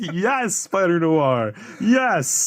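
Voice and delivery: high, delighted squeal of joy; excited fangirl squeal. Retold or imitated. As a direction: gush at him like a starstruck fan. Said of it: "And I was like".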